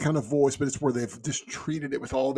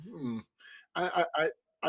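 ordinary delivery, nothing marked

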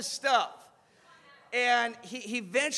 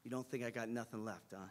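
low, soft, quickened wounded query; subdued question; 11 semitones lower, 13 dB quieter, faster than usual. Retold or imitated. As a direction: ask it under your voice. Hurt boxer asking quietly.

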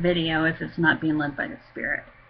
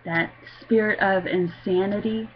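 normal talking voice

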